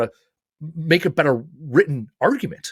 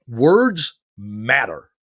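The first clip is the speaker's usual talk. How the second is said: very slow, each word drawn out and weighty; slow weighty slogan; 3 semitones lower, much slower than usual. Retold or imitated. Peterson's repeated slogan to the crowd, very slow and solemn. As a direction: lay down "Words matter." like a solemn motto, slowly. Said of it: "he kept saying".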